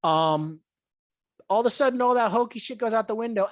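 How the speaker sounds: ordinary talk, nothing marked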